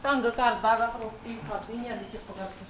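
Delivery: low grumble under the breath; sulky mother muttering to herself; muttered grumble. Acted. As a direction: mutter it off to the side, barely audible. Mother grumbles as others talk.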